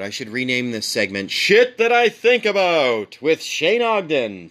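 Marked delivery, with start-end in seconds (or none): 1.3-4.5 high, sing-out title announcement; mock show announcer. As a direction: announce it like a cheesy segment title. Announces an imagined segment title.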